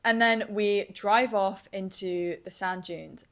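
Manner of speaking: ordinary talk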